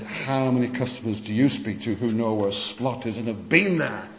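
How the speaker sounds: ordinary delivery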